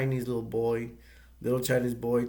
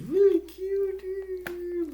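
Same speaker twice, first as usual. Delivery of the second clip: very high and slow, gushing; cutesy gushing; much slower than usual. Real, not acted. Kid gushing about his Gizmo toy in a very high, slow coo. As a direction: coo it like talking to a cuddly pet.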